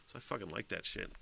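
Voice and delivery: low, very soft aside; quiet aside. Real, not acted. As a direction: say it under your breath, low. First, offhand take of repeated line.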